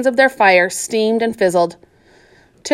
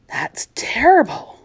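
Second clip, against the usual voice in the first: slow, hushed whisper; 5 semitones higher, slower than usual. Acted. Tiller whispering to Florida about Joey's death. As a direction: breathe it out in shock.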